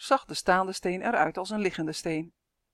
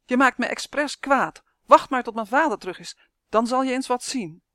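put-on raised, higher voice of an angry boy; angry shouting; 5 semitones higher, 6 dB louder than usual. A narrator's reading. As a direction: threaten loudly, like a child in a temper. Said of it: "schreeuwde hij". (boos en dreigend, met stemverheffing)

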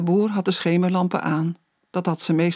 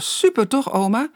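put-on higher, eager boyish delight; beaming excitement; 7 semitones higher than usual. A narrator's reading. Boy telling grandma about his first flight, excited. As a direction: bubble with anticipation. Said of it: "Roy begon te stralen".

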